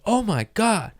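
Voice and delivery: high-pitched "can't believe the luck"; retold amazement. Retold reaction at drive-through window.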